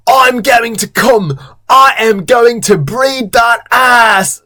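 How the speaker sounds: high, strained mock yell; drawn-out theatrical yelling; Tom. A narrator's reading. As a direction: holler it slowly, high and hammed-up. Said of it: "Tom starts to yell".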